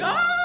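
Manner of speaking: very high short mock scream; imitated scream. Man falling through ice, mock high scream. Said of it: "I heard Beatty fucking scream".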